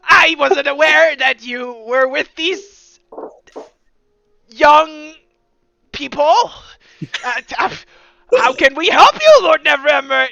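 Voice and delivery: loud, eager, flustered guard captain; fawning officer. Acted. Officer greeting the lord, loud and flustered. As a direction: grovel before the lord, stumbling.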